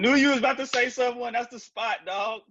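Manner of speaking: very high-pitched, thrilled outburst; real high-pitched excitement; excited recognition. Real, not acted. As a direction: burst out high, hyped to know the place. Excited recognition of the sandwich shop.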